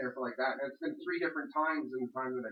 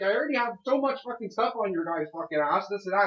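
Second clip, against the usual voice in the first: a touch louder, heated warning; retold angry threat; 5 semitones higher, 4 dB louder than usual. Tenant retelling threatening the guard, mildly heated and louder. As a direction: push the threat at him, heated. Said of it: "And I'm like".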